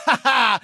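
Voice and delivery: loud laughing shout; smug bark of a laugh; gloating laugh. Acted. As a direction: crow about beating them all. Bragging about beating everyone.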